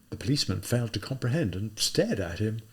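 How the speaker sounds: ordinary delivery, nothing marked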